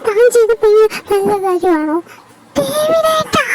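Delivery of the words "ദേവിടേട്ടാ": acted crying. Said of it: "കരയാതെ".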